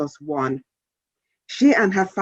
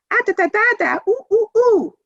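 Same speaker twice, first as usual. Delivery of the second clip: very high babbling coos; baby babbling along with siblings, high and cooing; baby babble. A narrator's reading. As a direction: babble like an infant. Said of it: "baby added".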